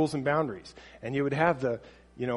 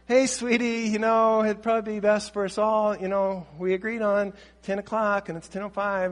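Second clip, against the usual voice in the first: higher, sugary coaxing tone; gentle sweet parent; 5 semitones higher than usual. Retold or imitated. Parent gently redirecting a late teen, higher sweet coaxing. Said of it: "redirect them real gently".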